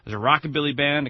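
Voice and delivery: normal talking voice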